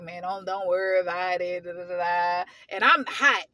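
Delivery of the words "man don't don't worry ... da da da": low, even, unbothered man voice; mock a guy brushing it off, pitch dropped; lazily dismissive impression of a calm husband; imitating laid-back husband. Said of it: "They just so cool and laid back".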